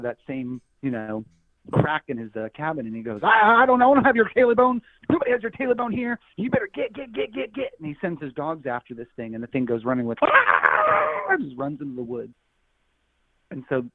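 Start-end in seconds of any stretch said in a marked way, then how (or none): 3.2-7.7 high, loud, panicked shooing; scared hermit yelling at a monster; frightened old man. Acted. 10.2-11.3 high, loud shriek; creature shriek. Acted. Creature runs screaming.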